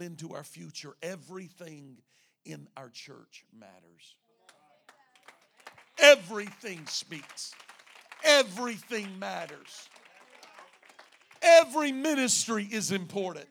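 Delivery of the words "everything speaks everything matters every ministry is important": loud, slow declarations falling at the end; emphatic preaching. Real, not acted. Pastor telling the church every ministry matters, emphatic loud preaching. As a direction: proclaim each phrase forcefully.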